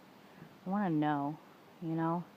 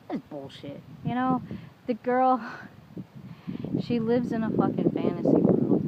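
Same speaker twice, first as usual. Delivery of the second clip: loud, higher, exasperated burst; angry outburst; 5 semitones higher, 6 dB louder than usual. Real, not acted. Ranting to camera about his lying ex, loud and angry. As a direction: let it explode, fed up with her lies.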